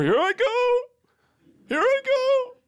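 very high, slow, hammed-up nervous courage; mock brave shopper. Shopper bracing to enter the store, hammed up. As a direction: psych yourself up like a cartoon hero.

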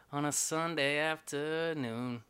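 slow laid back. Pretend. Laid back sung tag.

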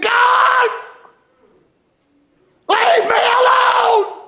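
very high, drawn-out mock scream; retold holler. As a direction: imitate a desperate man wailing at God. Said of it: "he was hollering and carrying on".

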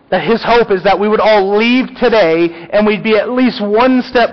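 impassioned preaching. Real, not acted. Pastor sending the church out closer to Christ, impassioned preaching. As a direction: drive the hope home with preacher's fervour.

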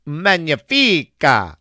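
kiss the fingers, Magnifica!; mock Italian exclaim. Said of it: "praising restaurant cuisine".

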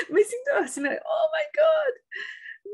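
high-pitched, crying out with emotion; overwhelmed awe. Retold or imitated. Speaker reliving hearing the woman sing, high emotional cry. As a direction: gasp it out as if your heart is bursting. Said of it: "Mi corazón explotaba".

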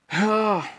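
sharp high cry when thrown back; a loud high pained shout. A narrator's reading. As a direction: yelp as you hit the floor.

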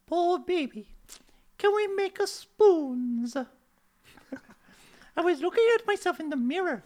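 put-on high, sweet-talking girlfriend; skit wife. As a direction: play the cooing girlfriend. Woman's voice in skit.

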